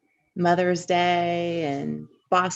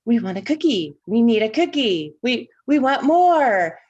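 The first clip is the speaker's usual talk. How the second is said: imitated kids' demanding chant, rising louder; mock childish clamor, higher and building in volume; clamoring children; 5 semitones higher, 6 dB louder than usual. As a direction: whine for treats like excited kids. Children chanting as they run through house.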